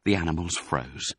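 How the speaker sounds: usual speaking voice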